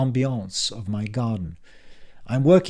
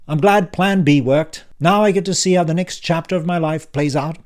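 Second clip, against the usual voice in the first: put-on louder, higher, impatient old man; brusque uncle Frank; 6 semitones higher, 7 dB louder than usual. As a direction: bark it like a testy uncle back from the dead. Frank muttering, pleased.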